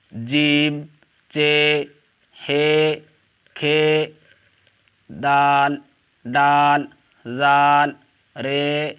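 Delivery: each letter called out loud and separate; alphabet recitation. Shown as a demonstration. Teacher calling out Urdu letters to online students, loud and deliberate. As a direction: drill the letters slowly for the class.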